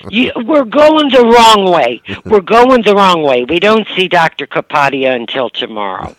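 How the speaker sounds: high, loud mock alarm; mother's alarmed outburst. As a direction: blurt it like a startled mom. Mother corrects daughter in car.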